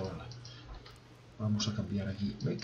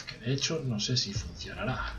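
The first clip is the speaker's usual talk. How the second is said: usual speaking voice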